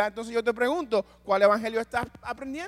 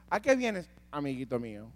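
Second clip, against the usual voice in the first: mock snide version; 6 semitones lower, 3 dB quieter than usual. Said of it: "Eso hubiéramos dicho".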